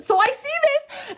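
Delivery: very high, slow indignant wail; worked-up, high-pitched exasperation; livid. Retold or imitated. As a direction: stretch it out high in outrage. Reliving rage at seeing video.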